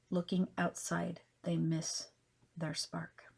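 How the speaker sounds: measured poetry reading with long pauses between phrases; poem recitation. Acted. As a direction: recite it line by line, punching openings, letting endings settle. Reads her own poem aloud.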